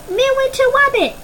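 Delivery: put-on very high toddler squeal, Wabbit said with a w; a little one shrieking Midwinter Wabbit; baby shouting. Puke wriggling free, squealing for the Midwinter Wabbit. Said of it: "Puke shouted".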